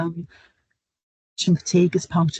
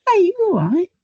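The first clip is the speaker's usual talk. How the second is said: cheery hey, pitched much higher and louder; high, louder caring greeting to a patient; friendly check-in; 13 semitones higher, 5 dB louder than usual. Retold or imitated. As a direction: call out kindly to a worried stranger. Greeting anxious man in waiting room.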